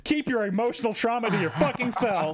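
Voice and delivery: high, sassy put-down voice; high, mocking customer-service snark; mock snarky rant. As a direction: scold him like a fed-up fast-food manager. Mock fast-food manager rant.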